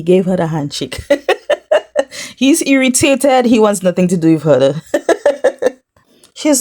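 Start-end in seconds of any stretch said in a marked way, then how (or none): 1.1-2.3 a burst of real high laughter. Real, not acted. Laughing as the snubbed hug is recapped. 4.9-5.7 short laugh. Real, not acted. Laughing at Jeremiah's irritation.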